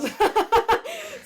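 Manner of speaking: very high loud laugh; high laugh at the memory; high squeal of laughter. Real, not acted. Laughing mid-story.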